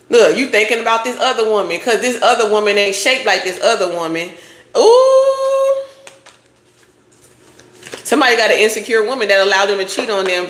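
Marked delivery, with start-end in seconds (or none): 4.8-5.8 a high sung "ooh" shooting way up. Real, not acted. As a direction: croon a teasing high ooh. Reacting with a sung exclamation.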